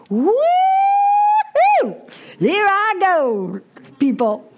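high, loud whooping cheer of freedom; gleeful whoop. Retold or imitated. Wife cheering as husband leaves for the week, high loud whoop. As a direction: shout it like a kid let out of school.

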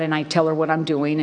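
ordinary talk, nothing marked

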